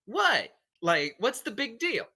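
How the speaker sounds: indignant in-character. Acted. She looks round the staring room, indignant. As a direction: throw it out high and loud, challenging them.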